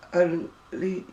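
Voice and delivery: ordinary talk, nothing marked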